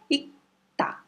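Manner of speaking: ita sussurrado, sem o r e o g; whispered demo. Shown as a demonstration. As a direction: diga ita só com o ar. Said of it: "A pronúncia dele vai ser ita". (ita sussurrado para mostrar a pronúncia)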